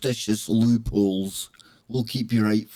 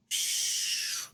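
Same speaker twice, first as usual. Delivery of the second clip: hushing; 6 semitones lower, 9 dB quieter than usual. Acted.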